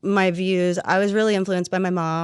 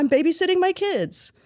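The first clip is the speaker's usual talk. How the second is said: mock proud dad; 10 semitones higher than usual. Mocking dads' phrase.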